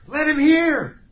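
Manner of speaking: loud, high pleading cry; impassioned cry. Acted. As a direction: cry it out to every listener. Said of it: "It's to cry out loudly".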